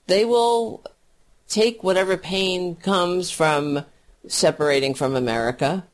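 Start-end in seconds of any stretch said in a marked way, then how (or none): none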